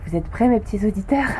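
ordinary talk, nothing marked

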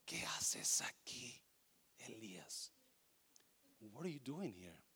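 slow, unvoiced whisper; whispered voice of God. God whispering to Elías in the cave. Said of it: "la voz de Dios es como un susurro".

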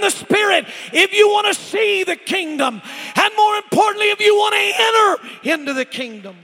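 roaring preacher, slowing on the big lines; full-force preaching. Real, not acted. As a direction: drive it home to the whole church at full voice.

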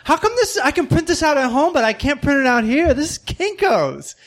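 shrill indignant yelling; mock angry yelling. Customer yelling at the Kinko's worker, high angry rant. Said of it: "yelling at the woman that works there".

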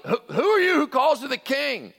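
groggy. Retold or imitated. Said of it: "you imagine a little groggy".

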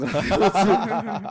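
slow laughing punchline. Real, not acted. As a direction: drag it out through laughter.

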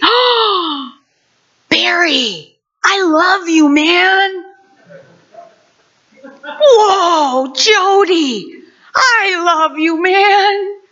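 high, delighted calls to friends; overflowing with sudden love; joyful discovery. Pretend. Preacher acting a believer greeting friends, joyful and high.

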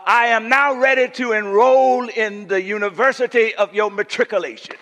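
high, loud, grandly sustained preacher; ringing impression of an eloquent preacher; Doctor Fred Sampson. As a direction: intone it like an imitated pulpit orator. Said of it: "That's how he talk".

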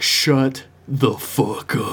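slow, drawn-out imitation of fury; controlled, hammed-up anger at normal volume; mock pissed off. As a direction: stretch each word with mock-angry weight. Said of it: "he's pissed".

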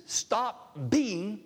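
Jesus commanding Thomas to have faith. Retold or imitated. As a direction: issue it as a command, word by word. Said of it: "Jesus in the name says".

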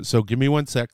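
usual speaking voice

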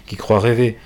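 plain reading voice

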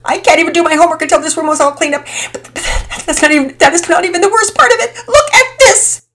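words tumbling out in tears; frantic girl building to a wail; fast tumbling words. Acted. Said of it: "words came at such a fast pace".

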